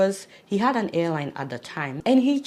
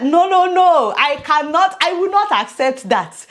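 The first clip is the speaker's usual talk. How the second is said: loud, high no no no; hammed-up loud refusal; mock refusal; 6 semitones higher, 8 dB louder than usual. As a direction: refuse it loudly, in mock protest. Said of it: "I said".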